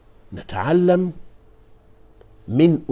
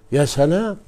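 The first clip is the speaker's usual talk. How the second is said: يا سلام louder with rising wonder; admiring exclamation; 4 semitones higher, 5 dB louder than usual. Shown as a demonstration. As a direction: marvel aloud at يا سلام. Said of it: "admiring Quran wording".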